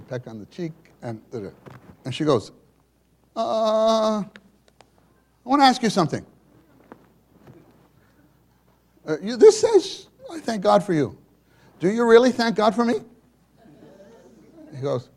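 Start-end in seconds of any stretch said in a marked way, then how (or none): 3.3-13.0 high, loud imitated mother; questioning mother. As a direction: probe him carefully.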